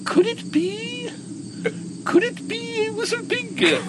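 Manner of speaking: high, slow, squeaky voice for the wild-eyed biker; excited biker. Retold or imitated. Retelling the mountain biker meeting Raj, high excited voice. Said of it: "and he goes".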